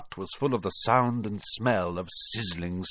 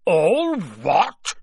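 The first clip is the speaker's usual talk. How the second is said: loud, raised, drawn-out roar; thundering; 9 semitones higher, 7 dB louder than usual. A narrator's reading. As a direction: thunder it back in outrage. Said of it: "Hagrid thundered".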